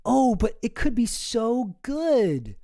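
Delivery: slow, high mock-dreamy gush. Mocking a tech guy hyping AI, slow high gush. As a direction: parody a starry-eyed tech optimist. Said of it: "trying to be like".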